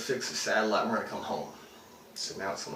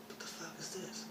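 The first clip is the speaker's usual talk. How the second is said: much softer, raised-pitch amazed question; hushed disbelief; 8 semitones higher, 14 dB quieter than usual. Retold or imitated. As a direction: mutter it to yourself in awe. Retold reaction to the orbs.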